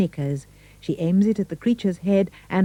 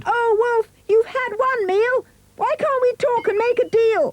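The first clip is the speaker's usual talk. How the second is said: very high pleading piglet voice; squealing pig; 3 dB louder than usual. A narrator's reading. Pig begging the wolf for a deal, high and squealing. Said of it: "He cried".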